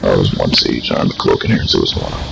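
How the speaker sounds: ordinary talk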